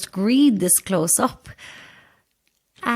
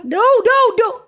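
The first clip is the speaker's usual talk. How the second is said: high, loud, flustered no's; rising panic as things go wrong; 12 semitones higher, 5 dB louder than usual. Acted. Flustered saleswoman searching her case.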